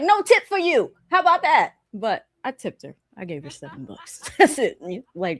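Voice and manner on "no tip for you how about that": higher, smug no-tip retort; paying the tech back with attitude; sassy refusal. Retold or imitated. Customer snubbing the tech, higher and smug. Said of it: "I was like".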